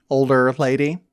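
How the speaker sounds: normal talking voice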